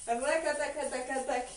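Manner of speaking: rapid "rakataka" percussion with the mouth; rhythmic vocal rattle. Shown as a demonstration. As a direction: sound out the hip trembling beat. Teacher voices the trembling rhythm.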